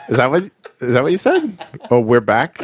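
ordinary delivery

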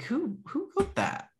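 high, slow disbelieving "Who, who"; shocked question. Retold or imitated. Retelling seeing the writing, mock shocked high question. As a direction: ask it stunned, voice jumping up. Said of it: "I was like".

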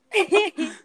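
a high, bright-pitched giggle; high squeaky laugh. Real, not acted. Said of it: "Why'd you laugh like [name]".

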